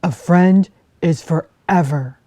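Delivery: loud, gruff big-bear voice; a big bear sending the fox away, loud and grumpy. Acted. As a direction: shoo her off like a cross old bear. Bear voice in story.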